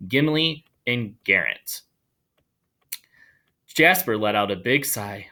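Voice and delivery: plain reading voice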